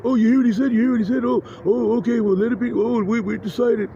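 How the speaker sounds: high, insistent chattering voices; imitated noises. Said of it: "And then, like, noises".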